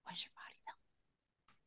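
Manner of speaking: soft unvoiced whisper; whispered question. Real, not acted. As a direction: breathe the question gently.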